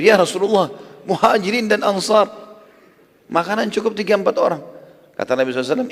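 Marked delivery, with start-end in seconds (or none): 0.1-4.6 alarmed protest. Acted. Jabir protests in alarm to the Prophet.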